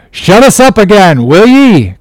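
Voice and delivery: high, forceful challenge; defiant cry. A narrator's reading. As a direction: cry it back at the captain. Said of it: "cried Steelkilt".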